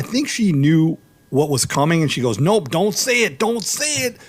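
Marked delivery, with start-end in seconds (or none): 2.4-4.2 put-on high, scolding old lady warning; stern old lady. Imitating the cashier shutting down his joke, high and scolding. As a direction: mimic the cashier forbidding the punchline. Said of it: "she was serious".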